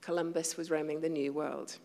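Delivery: normal talking voice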